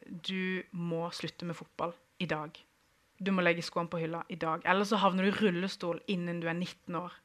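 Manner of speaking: put-on doctor telling the boy to quit football, low and soft; serious doctor. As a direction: deliver the bad news gravely. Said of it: "han er veldig seriøs".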